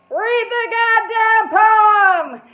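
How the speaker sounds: very loud, very slow, high bellow; drunk heckler yelling. Retold or imitated. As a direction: holler it from the bar stool. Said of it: "I hear this guy".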